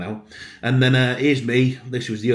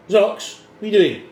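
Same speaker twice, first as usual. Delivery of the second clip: raised voice at the cat; calling out to the cat, telling him off; 9 semitones higher, 7 dB louder than usual. Real, not acted. Scolding the cat on the windowsill.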